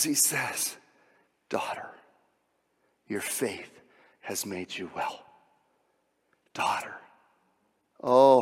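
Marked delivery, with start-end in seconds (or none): 1.5-5.2 soft, low and gentle, almost hushed; quiet tender blessing. Acted. Jesus speaks tenderly to woman. 6.5-6.9 breathy whisper of one word; hushed tender whisper. Acted.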